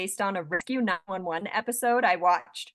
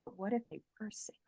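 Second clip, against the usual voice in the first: much softer, half under the breath; quiet aside; 16 dB quieter than usual. Retold or imitated. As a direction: drop it to a sly murmur. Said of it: "And I'm like".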